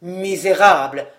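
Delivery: low-pitched, forceful insult; contained indignation; contempt, voice unraised. A narrator's reading. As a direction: condemn him without raising the pitch. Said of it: "poursuivit Georges sans élever la voix".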